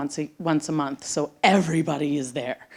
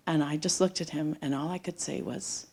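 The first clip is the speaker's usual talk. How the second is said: ordinary talk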